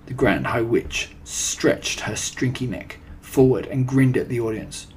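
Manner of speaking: ordinary delivery, nothing marked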